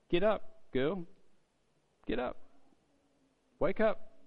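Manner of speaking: gentle wake-up call. Acted. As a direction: wake a sleeping girl gently. Waking the girl like a parent.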